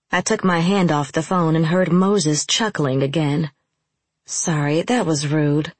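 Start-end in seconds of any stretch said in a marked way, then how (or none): none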